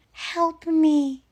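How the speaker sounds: put-on very high, tiny child's plea; fake plea for help. Kid at drive-through window trolling his parent, high-pitched fake plea. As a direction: squeak it out like a pretend kidnap victim. Kid fakes being kidnapped.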